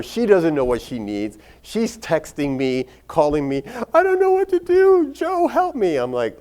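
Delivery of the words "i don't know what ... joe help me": very high, loud wail for help; hammed-up panic, squealed high; panicked plea. Patient calls her doctor from the dispensary, hammed-up panicked plea.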